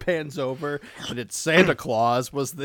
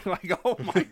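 ordinary talk

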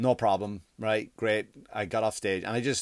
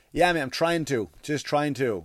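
retold irritated reply; 4 semitones higher, 6 dB louder, slower than usual. Acting out his irritated reply while hungry.

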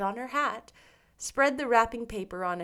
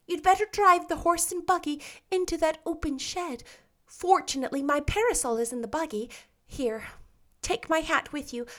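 put-on raised, eager chatter of a dramatic girl; brightly voiced young heroine fretting; Anne's lively girlish voice; 5 semitones higher, 6 dB louder than usual. A narrator's reading. Anne stuck in the roof, fretting to Diana in a raised girlish voice.

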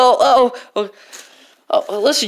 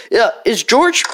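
usual speaking voice

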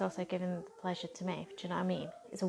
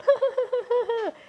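high loud laugh; 7 dB louder than usual. Real, not acted. After mocking the troll.

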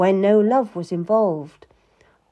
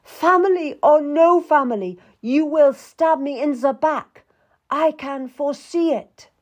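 high, loud, anguished accusation; crying out; 8 semitones higher, 5 dB louder than usual. A narrator's reading. Queen accusing the Duke of betrayal, crying out angrily. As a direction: cry out against the betrayal. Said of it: "then cried out".

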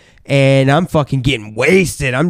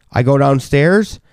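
ordinary talk

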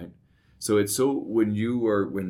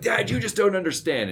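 higher, louder mock of a frustrated teenage daughter; upset daughter; 12 semitones higher, 5 dB louder than usual. Dad imitating his daughter's frustrated protest, higher and louder. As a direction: play the daughter storming off, exasperated. Daughter's quote in a heated argument.

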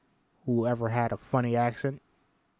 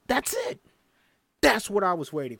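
much higher delighted "That's it."; thrilled praise; 6 semitones higher than usual. Acted. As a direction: squeal with delight at her sound. Coach thrilled by her belt.

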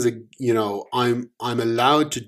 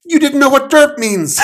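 very high, loud accusing cry; gurgled shout; 11 dB louder than usual. Acted. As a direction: squeal the accusation. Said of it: "Omen gurgled".

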